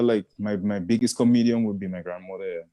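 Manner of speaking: ordinary talk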